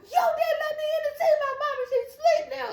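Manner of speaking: very high-pitched wailing blame; imitated crying uncontrollably. Daughter blaming Papa for the missed visit, imitated wailing. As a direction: wail it like an inconsolable kid. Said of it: "I'm crying uncontrollably".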